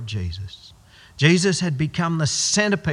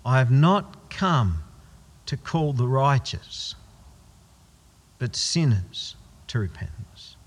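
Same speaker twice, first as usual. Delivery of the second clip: NOT and "sinners" stressed slowly; slow emphatic repeat. Shown as a demonstration. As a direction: lean on "not" and "sinners", word by word. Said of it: "Let those words sink in".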